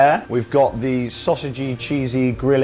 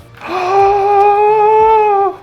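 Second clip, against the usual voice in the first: dramatic gasp; 8 dB louder than usual. Acted. Gasp after life-after-death bit.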